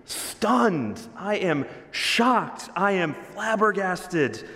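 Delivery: a hint of a shocked spiritual father; amazed. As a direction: voice Paul's shock, each phrase drawn out. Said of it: "Paul says".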